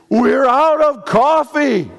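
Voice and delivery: high, loud, slow alarm over nothing; panicked crisis person. Shown as a demonstration. Preacher mimicking a crisis person, high and loud panic. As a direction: blow a small problem into a disaster.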